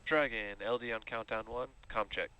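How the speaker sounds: low filtered radio transmission; astronaut radio. Real, not acted. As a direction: speak over a crackly comms channel. Crew com check over radio.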